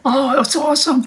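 ordinary talk, nothing marked

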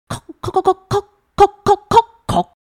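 rapid high imitated knocks; rhythmic knocking. A narrator's reading. Narrator imitates a code knocked on the door, rhythmic knocking. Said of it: "有节奏的敲打的门环".

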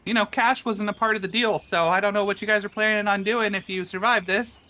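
high, quick, pompous voice; high fast rich guest voice. Acted. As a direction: talk like a fussy rich guest. NPC guest voice continues.

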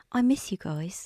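stretched-out imitation of Nicole's Australian accent, slow. Correcting the first take of Nicole, slow Australian accent. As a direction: draw out each word in a mock Australian accent. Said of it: "Like that, more like that".

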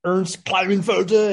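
slow, exaggerated German pronunciation; hammed-up German accent, drawn out. Host mocking Ernie's German overtones, German accent. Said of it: "fucking German undertones or maybe overtones".

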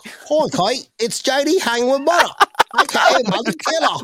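badly done accent drifting into Australian; James Dunning's accent. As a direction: put on a wobbly, Australian-sounding accent. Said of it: "I'm gonna do it in, uh, James Dunning's accent".

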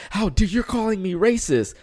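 high, offended how dare you; mock indignation. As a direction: huff it like a wounded troll. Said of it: "he's just kinda like".

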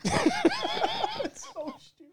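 squeaked out through laughter; laughing. Real, not acted. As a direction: barely get it out for laughing. Laughing at the prank.

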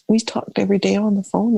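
ordinary delivery

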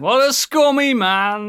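slow, high, disgusted sneer; mock disgust. Real, not acted. Mock outrage at singer.

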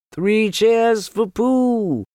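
slow, high, loud cheering. Acted. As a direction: cheer it out slowly. Cheering chorus.

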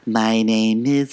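slow, drawn-out villain intro; Regina George delivery. Retold or imitated. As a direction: lightly mimic a diva's slow self-introduction. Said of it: "the way she says".

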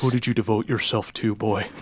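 lightly voiced villain, sinking low on the last word; understated quiet challenge to a youngster; imposing interrogator. Villain interrogates the young paladin, quietly imposing. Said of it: "and he goes".

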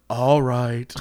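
low, resigned mock surrender; lightly acted defeat; beat down. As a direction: give in without a fight. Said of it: "I was so beat down".

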